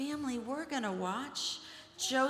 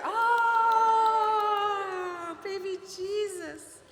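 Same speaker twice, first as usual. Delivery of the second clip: much higher and louder sung "Oh"; adoring animals; 11 semitones higher, 8 dB louder, much slower than usual. Acted. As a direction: coo over the newborn like the animals. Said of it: "the animals gathered around closer".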